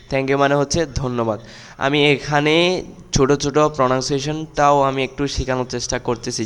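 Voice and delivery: normal talking voice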